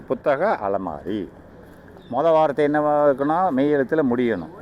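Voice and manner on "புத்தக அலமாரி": slow, low புத்தக அலமாரி, last vowel stretched; slow word joining. Shown as a demonstration. Teacher joining two example words for students, slow demonstration. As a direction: spell out the compound slowly, part by part. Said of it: "அப்ப இது correct".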